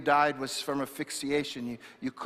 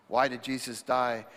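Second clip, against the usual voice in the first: usual speaking voice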